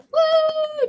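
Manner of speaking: high whooping woo; mock cheer. Kids cheering the skater on, high whoop.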